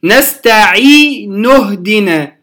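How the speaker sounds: نستعين اهدنا joined, the ي of نستعين held two counts; natural madd in connection. Shown as a demonstration. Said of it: "العين مكسورة". (وصل نستعين اهدنا بمد طبيعي)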